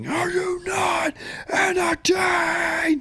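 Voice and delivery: very slow, high-pitched scream. Shown as a demonstration. Fan showing how it should have been, screaming. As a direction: scream each word out long, stretching the ending. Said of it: "like, screaming".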